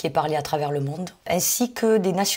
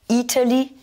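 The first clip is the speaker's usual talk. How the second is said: Italy, I raised high and punched; stress on first syllable; 6 semitones higher, 3 dB louder than usual. Shown as a demonstration. Teacher modelling the country name, stress on I in Italy. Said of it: "première syllabe pour le pays".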